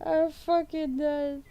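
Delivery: high, slow, drawn-out amusement; helpless amusement. Real, not acted. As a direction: squeak it out slowly, cracking up. Cracking up at a chat comment.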